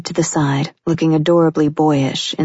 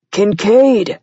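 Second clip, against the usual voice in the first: loud, higher impatient shout; impatient call; 5 semitones higher, 4 dB louder than usual. A narrator's reading. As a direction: call across the store impatiently. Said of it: "Nick called out impatiently".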